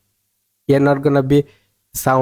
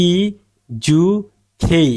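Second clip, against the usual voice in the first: ইজুকেই very slow and higher, syllable by syllable; stressed "kei"; 4 semitones higher, much slower than usual. Shown as a demonstration. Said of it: "এটা জোর দিতে হবে". (ইজুকেই, "কেই" বড় করে জোর দিয়ে)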